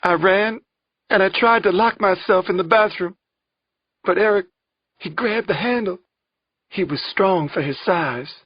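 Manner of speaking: high, loud tearful account; distressed, high-pitched testimony; fighting through tears. A narrator's reading. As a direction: relive the panic, struggling against tears. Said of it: "fighting through tears".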